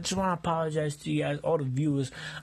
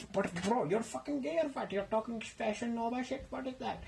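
lightly voiced annoyed roommate, a bit higher; nosy roommate needling him, slightly higher pitch; intruding roommate; 6 semitones higher, 7 dB quieter than usual. Roommate teasing.

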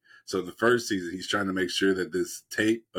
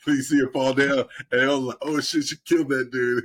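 louder, higher, worked up; excited; 5 semitones higher, 4 dB louder than usual. Real, not acted. Telling a friend about the show's shooting scene, excited. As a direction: tell it like you can't believe it.

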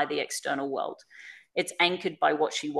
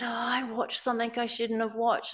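lightly voiced child, higher pitch; imitated daughter; 6 semitones higher, 3 dB quieter than usual. Daughter confessing to her parent, lightly imitated child voice.

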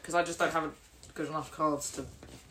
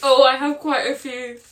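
higher, louder words through real laughter; laughing; 5 semitones higher, 5 dB louder than usual. Real, not acted. Player with many funny cards, talking through laughter. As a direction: speak while still cracking up. Still recovering from laughing fit.

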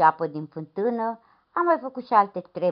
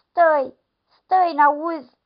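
calling after him; 10 semitones higher, 5 dB louder, much slower than usual. Acted. Woman calls after the leaving lad.

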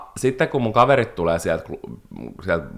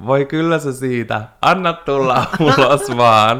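mock soothing; 4 semitones higher, 6 dB louder, slower than usual. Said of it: "kun minä hoin".

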